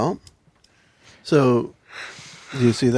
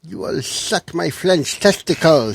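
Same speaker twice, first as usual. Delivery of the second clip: put-on French accent; 3 semitones higher, 5 dB quieter, slower than usual. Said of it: "I can't do a French accent".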